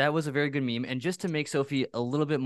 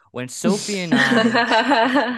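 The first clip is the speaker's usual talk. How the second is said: slow, drawn-out, high and loud teasing announcement; dramatic buildup; 11 semitones higher, 10 dB louder, much slower than usual. Pretend. Teasing the co-host with a slow grand high loud setup. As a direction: stretch it out like a big reveal. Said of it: "just to really embarrass Sophie".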